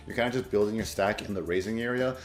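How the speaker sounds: usual speaking voice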